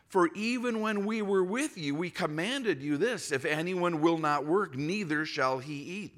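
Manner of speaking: preacher reading Thessalonians aloud, a touch of emphasis; scripture reading. Acted. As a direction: proclaim the passage to the congregation. Reads scripture passage aloud.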